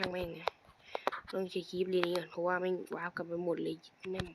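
annoyed whisper to himself; whispered grumble. Real, not acted. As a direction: hush the swearing so it barely carries. Grumbling swear under breath.

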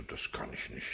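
whispered, deflated admission; barely audible admission. Acted. Cornered taxi driver whispers to the defence lawyer. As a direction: let it slip out under the breath, caught out. Cornered witness admits he cannot remember.

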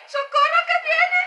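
high, loud shout of fright; panicked cry for help. Real, not acted. Player fleeing an approaching monster, yelling in panic. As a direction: yell for help as it closes in.

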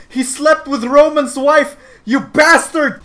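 high, loud accusation; playful outraged yell; mock angry. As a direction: shout out the betrayal with hammed-up rage. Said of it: "Roman is angry!".

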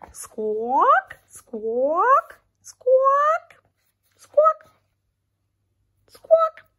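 a flock of birds squawking, climbing up; squawk, squawk, getting shriller. Acted.

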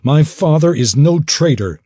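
"no" punched loud and high in hurt fury; angry tearful cry. Acted. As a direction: hurl "no" out, near tears of anger. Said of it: "Tears of anger gathered".

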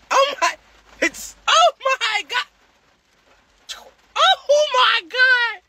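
high, drawn-out slow oh my God; stunned by the hit, stretch it out; shocked oh my God. Real, not acted. Reacting to a big hit on live, stunned.

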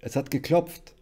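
high, carrying shout; calling out. A narrator's reading. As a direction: call it through the house. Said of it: "rief sie".

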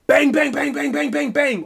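loud, high, rapid bangs; imitated banging. Police pound on the door as he sleeps, loud mock banging. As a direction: pound out the door knocks with your voice.

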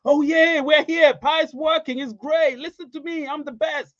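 high, puffed-up show-off voice, mocking; mock bragging celebrity. Streamer mocking celebrity pie promoters, high boastful mock voice. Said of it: "they're like".

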